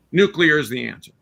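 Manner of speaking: normal talking voice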